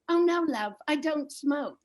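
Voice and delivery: a British husband misunderstanding, lightly voiced; polite English husband. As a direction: correct her kindly like a Brit. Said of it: "he says".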